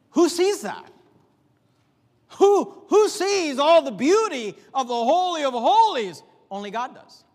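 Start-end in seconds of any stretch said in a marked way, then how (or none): 0.1-6.2 louder and higher rhetorical question; rising preaching. Real, not acted. Preacher asking the congregation who sees, louder and higher. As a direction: lift it to the rafters, slowly.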